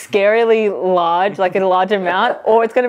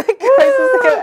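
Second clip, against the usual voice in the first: laughing; 4 dB louder than usual. Real, not acted. Laughing after gross challenge.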